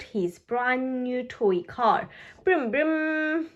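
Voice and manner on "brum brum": put-on brum brum like a child's toy car; lightly voiced engine noise. A narrator's reading. Richard playing with his new toy car, brumming.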